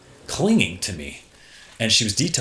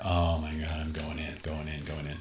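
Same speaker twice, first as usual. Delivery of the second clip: much softer, lower, fading mutter; weak, drained; 7 semitones lower, 9 dB quieter than usual. Retold or imitated. Bleeding surfer dragging himself ashore, weak and drained. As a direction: speak like you have no strength left.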